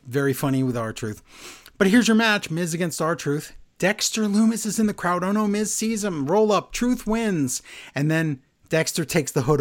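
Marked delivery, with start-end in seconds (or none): 1.8-7.6 higher, lively match call; a touch of commentator excitement; mock play-by-play. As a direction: rattle off the match like an announcer. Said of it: "play-by-play recap".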